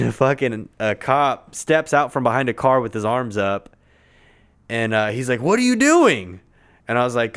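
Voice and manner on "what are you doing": high, loud mimicked cop demand; mock cop yell. Cop yelling at the rider in the street. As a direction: bark it like a startled cop.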